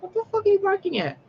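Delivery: very high, fast mock outburst; high-pitched, rushed disbelief; retold exasperation. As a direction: squeal the question at the dog. Retold exasperated question to barking dog.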